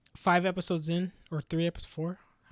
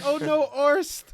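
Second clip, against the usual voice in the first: mock grief; 3 semitones higher than usual. Mocking the heroes mourning Orst, hammed-up grief. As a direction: wail over the fallen friend, tongue in cheek. Mocking characters' fake sad farewell.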